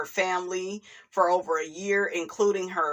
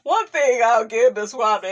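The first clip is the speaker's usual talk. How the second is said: ordinary talk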